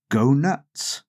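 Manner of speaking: ordinary delivery, nothing marked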